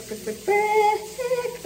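high, slow drawn-out cries; in an ice bath. Real, not acted. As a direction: squeal it through the cold. Cold water shock.

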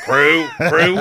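high, loud call to the crew; mock captain call. Host imitating the captain rallying the crew. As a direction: summon the crew dramatically. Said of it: "he's like".

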